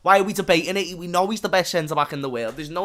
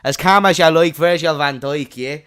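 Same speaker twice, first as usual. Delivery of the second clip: put-on Irish accent; 7 dB louder than usual. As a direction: put on a broad Irish brogue like a commentator. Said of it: "they need an Irish fella".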